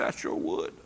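whispered yes, barely voiced; soft whisper. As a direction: breathe the answer. Quoted woman's quiet reply.

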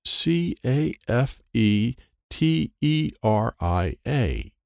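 slow letter-by-letter sounding out; spelling out. A narrator's reading. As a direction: read each letter carefully. Spelling out a sign slowly.

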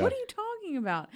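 high-pitched baffled question; incredulous, pitched very high; retold disbelief. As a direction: act out total confusion, voice way up. Acting out disbelief at the news.

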